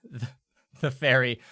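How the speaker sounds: ordinary delivery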